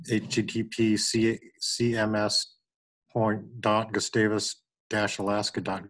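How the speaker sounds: slow, spelled out letter by letter; dictating. Real, not acted. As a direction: read the address out slowly for someone typing. Spelling out a web address.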